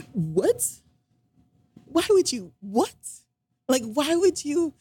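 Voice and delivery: high, incredulous what-the-heck; indignant disbelief. Real, not acted. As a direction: react in stunned disbelief, pitch shooting up. Her reaction to the jab.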